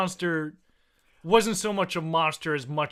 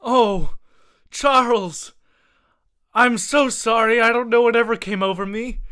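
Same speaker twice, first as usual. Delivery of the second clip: pleading wife; 5 semitones higher, 5 dB louder than usual. Acted. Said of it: "And she says".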